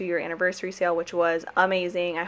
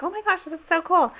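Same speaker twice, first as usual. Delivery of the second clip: much higher, gushing excitement; ecstatic; 11 semitones higher than usual. Retold or imitated. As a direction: squeal it like great news. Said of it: "and I was ecstatic".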